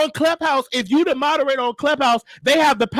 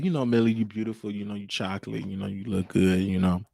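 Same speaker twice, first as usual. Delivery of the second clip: very low, soft sweet-talk; butter them up in a hushed deep voice; smooth-talker's put-on bass murmur; 9 dB quieter than usual. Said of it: "trying to put on my D voice".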